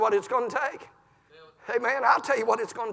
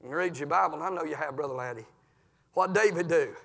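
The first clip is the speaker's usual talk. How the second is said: normal talking voice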